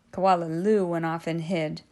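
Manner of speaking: usual speaking voice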